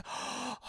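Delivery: high breathy gasp of awe; mock gasp. Willie seeing the diamond, a high mock gasp.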